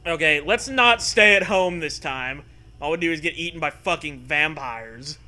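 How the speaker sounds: ordinary talk, nothing marked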